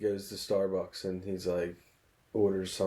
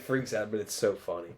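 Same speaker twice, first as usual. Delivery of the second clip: normal talking voice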